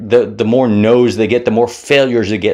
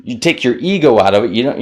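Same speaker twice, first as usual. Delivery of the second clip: ordinary talk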